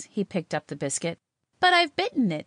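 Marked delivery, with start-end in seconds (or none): 1.6-2.4 much higher, louder protest; startled exclamation. A narrator's reading. Girl protesting as he takes her biscuit, exclaiming. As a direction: exclaim it in high-pitched surprise. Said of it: "exclaimed Celia".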